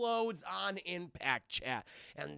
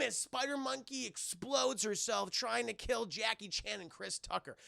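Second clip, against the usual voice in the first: ordinary talk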